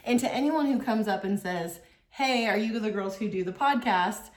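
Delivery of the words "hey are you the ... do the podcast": ordinary delivery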